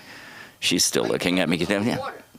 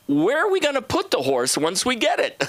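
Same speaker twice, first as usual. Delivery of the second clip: clueless family's voice; 12 semitones higher than usual. Retold or imitated. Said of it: "They didn't think ahead, like".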